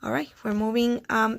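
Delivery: ordinary talk, nothing marked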